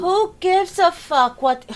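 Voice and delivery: high, loud and slow outburst; outraged shout. Real, not acted. Reacting to the cat owner's video, an outraged shout. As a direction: blurt it out in disbelief.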